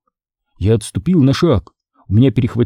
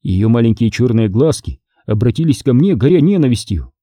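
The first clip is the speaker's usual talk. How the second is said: ordinary delivery, nothing marked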